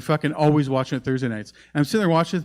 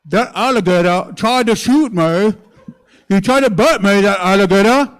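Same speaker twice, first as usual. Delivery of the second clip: Cajun accent with mouth wide open; exaggerated Louisiana gator-hunter impression; 4 semitones higher, 7 dB louder than usual. Shown as a demonstration. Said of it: "how to do the Louisiana accent".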